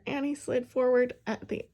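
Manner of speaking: plain reading voice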